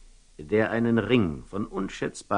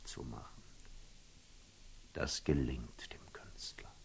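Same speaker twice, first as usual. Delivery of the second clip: lower, softer whisper; hushed reveal in the tale; storyteller's whisper; 8 semitones lower, 19 dB quieter than usual. Acted. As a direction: let it fall to a hush.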